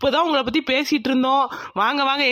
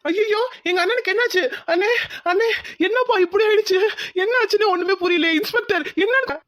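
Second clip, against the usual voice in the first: shocked grief; 7 semitones higher than usual. Acted. Brother sees dead brother.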